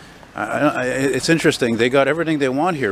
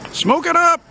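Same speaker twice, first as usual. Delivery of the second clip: much higher, bursting cheer; gleeful call; 3 dB louder, slower than usual. Real, not acted. Vlogger cheers on strangers barbecuing in the park, gleefully.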